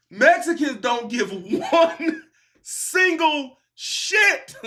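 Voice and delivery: slow, word-by-word emphasis with laughs breaking in; emphatic. Real, not acted. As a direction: draw out "one single", then punch "shit" high. Emphasising how little they care.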